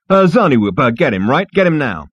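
loud, pushy, impatient; impatient demand. Acted. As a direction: push him to hurry.

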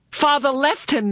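put-on high, upset girl's cry; anguished cry. A narrator's reading. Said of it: "Meg cried".

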